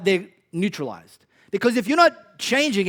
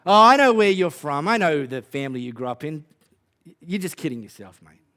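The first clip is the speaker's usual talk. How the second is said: put-on Aussie accent; 2 semitones lower, 5 dB louder than usual. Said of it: "I have to do it in Aussie accent".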